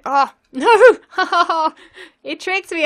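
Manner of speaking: loud burst of laughter into a high No; laugh-yell at being tricked; shocked laughing shout. Real, not acted. Tricked by the maze.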